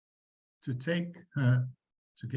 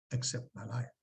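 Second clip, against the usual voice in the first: softer, trailing repeat; soft echo; 6 dB quieter than usual. Acted. Teacher echoing Hamlet's words, soft. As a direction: let the phrase fall away.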